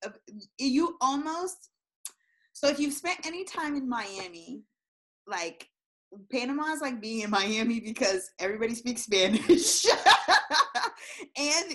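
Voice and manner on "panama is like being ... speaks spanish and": laughing. Real, not acted. Laughing while describing Panama.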